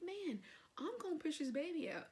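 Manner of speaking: high-pitched exasperated outburst; retold impatience. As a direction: replay being fed up with waiting. Retelling her impatience while waiting to push.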